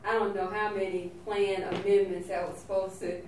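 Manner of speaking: ordinary talk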